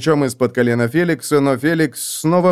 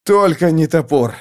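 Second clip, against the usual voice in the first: breathless gasp; 4 semitones higher than usual. Acted. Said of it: "выдохнул Феликс".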